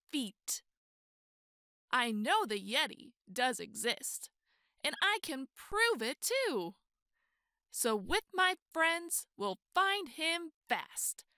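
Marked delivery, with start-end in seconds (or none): none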